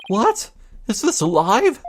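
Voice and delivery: high startled yelp; shocked to see themselves on TV; surprised. Acted. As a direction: caught off guard on live TV. Sees themselves on TV.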